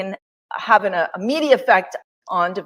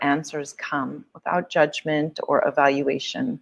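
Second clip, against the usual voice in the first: guided meditation; 4 semitones lower than usual. Acted. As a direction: ease them into stillness, gently.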